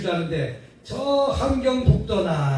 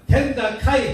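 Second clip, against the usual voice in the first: a hint of Gyeongsang dialect, a bit louder; 2 semitones higher, 4 dB louder than usual. Preacher showing the congregation Gyeongsang speech. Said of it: "경상도 가니까".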